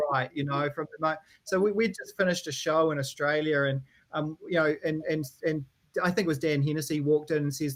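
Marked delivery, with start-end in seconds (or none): none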